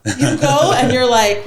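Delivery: ordinary talk, nothing marked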